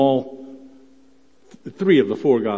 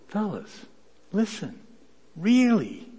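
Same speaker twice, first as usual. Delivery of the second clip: higher, earnest appeal; voicing Jesus urging; 12 semitones higher, 4 dB louder than usual. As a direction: lean in and press the point. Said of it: "Jesus could have been saying something like this".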